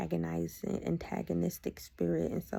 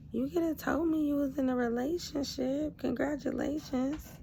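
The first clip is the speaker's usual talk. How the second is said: mock congratulations; 7 semitones higher than usual. Woman texting her ex about his new girlfriend, mock congratulations. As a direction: congratulate him pointedly, pitched high.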